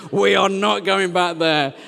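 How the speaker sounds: loud, slow, word-by-word refusal; emphatic refusal. Retold or imitated. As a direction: put your foot down loudly and slowly. Refusing child's plea, emphatic take.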